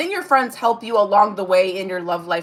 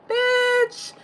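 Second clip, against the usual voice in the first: sassy squeal; 14 semitones higher than usual. Pretend. Punchline after mock warning.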